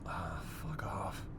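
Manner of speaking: slow, whispered grumble; low, whispery muttered swearing; muttered curse. Acted. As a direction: curse under your breath, drawn out. Grumbling alone in a tense car scene.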